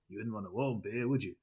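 lightly mock an old Aussie beer ad in an Australian accent; attempted Australian accent. Quoting an old beer slogan to his co-host, in a rough Australian accent. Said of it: "that's an attempt at an Australian accent".

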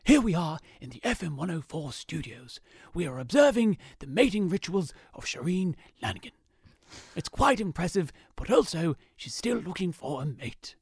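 hushed, whispery nature-documentary narration; breathy wildlife-documentary hush; Attenborough impression. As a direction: narrate her like a rare animal in the wild. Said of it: "let me try that again".

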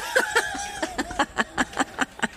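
a shrill burst of laughter, high and soft; high squealing laugh. Real, not acted. Listener laughs at the song.

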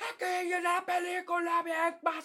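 high, hammed-up hype yell; imitating a screaming TikToker; mock shouting hype. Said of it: "cuando gritan de que".

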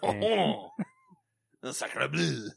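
mock French sacre bleu; French accent. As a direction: toss out a hammy French exclamation.

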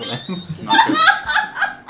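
laughing. Real, not acted. Laughing protest.

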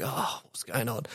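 very soft, slow hungover mumble; groggy, as if just woken by the phone; hungover waking. Retold or imitated. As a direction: act out a faint morning-after murmur. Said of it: "wakes up with a splitting hangover".

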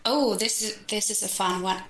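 ordinary delivery

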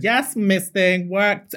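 high, slow, sassy "yes Miss Thing"; drawn-out diva praise; sassy compliment. Retold or imitated.

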